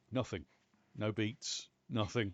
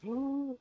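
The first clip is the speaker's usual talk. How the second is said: sharp gasp of delight; loud excited gasp; 12 semitones higher, 4 dB louder than usual. Real, not acted. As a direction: gasp at the loot. Excited find in game loot.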